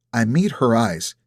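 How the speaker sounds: usual speaking voice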